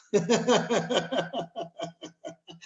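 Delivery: real high amused laugh; breezy high laugh at the idea. Real, not acted. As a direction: let a real laugh roll out. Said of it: "I laugh just like I did".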